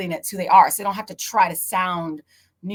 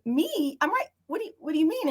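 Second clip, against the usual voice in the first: confused surprise; 9 semitones higher than usual. Retold or imitated. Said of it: "And I was like".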